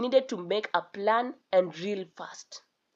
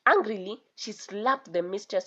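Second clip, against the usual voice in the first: usual speaking voice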